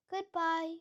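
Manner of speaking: ordinary delivery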